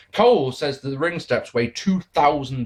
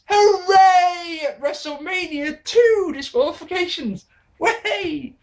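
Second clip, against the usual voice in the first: much higher, louder mock celebration; sarcastic hooray; 4 dB louder, slower than usual. Real, not acted. Reviewer mocking a second disqualification, loud sarcastic cheering. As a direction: cheer with biting irony.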